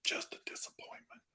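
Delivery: hushed mock dismay; whispering. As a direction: whisper it in fake despair.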